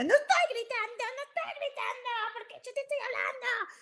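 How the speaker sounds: high-pitched screechy self-parody; gritona en negación. Retold or imitated. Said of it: "Y yo le decía". (chillido agudo imitando su propia gritería)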